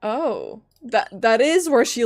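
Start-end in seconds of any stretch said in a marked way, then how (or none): none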